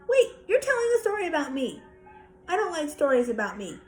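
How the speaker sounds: put-on high, loud and fast, a little girl protesting; indignant protest. Little sister interrupts her brother's bedtime story, high and fast. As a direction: cut in quickly, offended it's about her.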